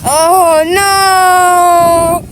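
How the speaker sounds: loud drawn-out "oh no"; play-acted cry of dismay; dismayed wail. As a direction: wail it long and loud in alarm. Dismay at the crab trap.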